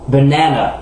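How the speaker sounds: "banana" with a long, strong middle syllable; stressed middle syllable. Shown as a demonstration. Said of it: "prononcée plus fort et plus longtemps". (« banana » accentué et allongé sur la deuxième syllabe)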